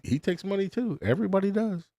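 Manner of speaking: usual speaking voice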